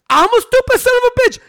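high, strained outraged imitation; Stone Cold Steve Austin mad. Imitating the wrestler answering the manager, high-pitched mock outrage. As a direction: mimic an incredulous angry wrestler, pitch shot way up. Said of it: "Stone Cold got so mad".

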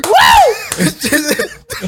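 high, loud cheering woo with a laugh; mock cheer. Imagining the nurse high-fiving his joke, loud cheer.

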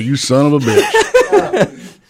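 slow, laughing disbelief; laughing outrage. Retold or imitated. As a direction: drag it out through laughter, half-shocked. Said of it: "I'm like".

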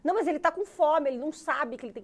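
high, loud, argumentative insistence; imitated nagging mother. Grandmother argues the baby is hungry, loud and insistent. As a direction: argue back loudly in a mother's voice.

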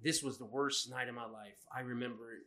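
a hint of a grim confession read aloud; reading the story. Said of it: "it starts out like".

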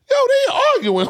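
very high-pitched incredulous exclamation; mock disbelief. Onlooker reacting to parents' calm arguing, high mock disbelief. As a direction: squeal it in mock amazement.

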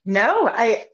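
usual speaking voice

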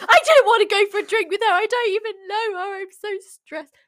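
mock panic about the date; panicked. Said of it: "I was like".

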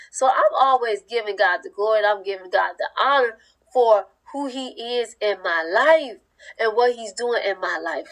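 normal talking voice

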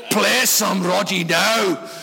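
put-on slow, insistent drunken old man. Old guy insisting to the young keyboard player, slow and drunken. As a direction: drag out a slurred demand. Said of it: "had one or two too many milkshakes".

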